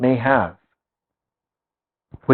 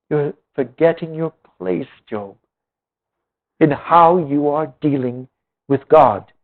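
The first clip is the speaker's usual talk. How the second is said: usual speaking voice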